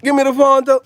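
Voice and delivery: high Jamaican patois, playful; mock Jamaican accent. Vlogger imagining himself in Jamaica, mock Jamaican accent. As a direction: ask in a put-on Jamaican accent. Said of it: "Go to Jamaica, be like".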